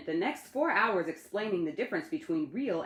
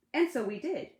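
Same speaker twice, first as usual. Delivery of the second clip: ordinary delivery, nothing marked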